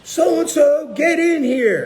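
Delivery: high, loud holler for someone; imitated scream. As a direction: call out shrilly, imitating a boss summoning staff. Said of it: "she screamed out loud".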